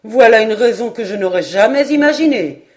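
loud, astonished retort; exclaiming. A narrator's reading. Paganel taken aback by Glenarvan's reasoning, exclaiming. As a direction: cry out at the absurd reason. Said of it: "s'écria Paganel".

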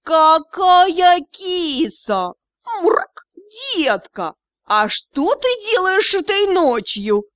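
high, slow, drawn-out cat flirtation; purring talking cat. A narrator's reading. Talking cat flirting with the visiting captain, purring. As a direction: purr it, stretching every vowel. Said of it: "Счастливчик принял его за согласие и промурчал".

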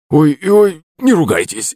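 put-on high drunken yelp, easing down; cowering plea. A narrator's reading. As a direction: beg the master not to scold. Drunk cowers before master. (испуганная мольба)

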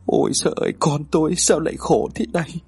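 thin, half-voiced wail of pity; tearful lament. A narrator's reading. Mother pitying her suffering child, tearful lament. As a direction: lament as if close to tears. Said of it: "Hai mắt của bà rưng rưng".

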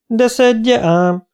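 high, slow, sharp-tongued retort; dühös asszony. A narrator's reading. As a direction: talk back angrily, drawing it out. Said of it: "nyelvelt a dühös asszony". (dühös, magas hangú nyelvelés)

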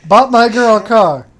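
loud, high southern accent. Shown as a demonstration. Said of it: "I had to say it with a southern accent".

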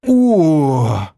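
long drawn-out oh. A narrator's reading. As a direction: stretch the oh out. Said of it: "протянул игрок".

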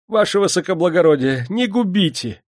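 high, loud desperate plea; terrified wail of entreaty; crying out. A narrator's reading. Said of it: "возопил он".

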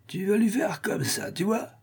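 high, soft coaxing of a pet; drunken master. A narrator's reading. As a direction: show the monkey, voice lifted and hushed. Drunk master instructs his monkey.

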